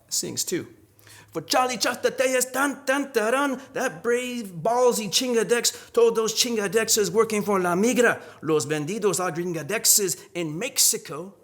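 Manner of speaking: high, loud spoken-word delivery; poetry performance. Acted. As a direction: perform it like a corrido ballad. Performs poem at reading.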